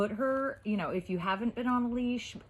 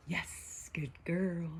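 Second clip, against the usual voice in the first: whispered praise; 4 dB quieter, much slower than usual. Real, not acted.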